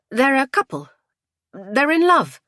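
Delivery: high, loud, emphatic explanation; emphatic woman. A narrator's reading. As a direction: spell it out firmly in a high voice. Woman spells out the romance.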